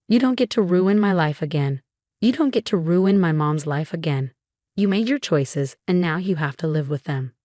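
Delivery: usual speaking voice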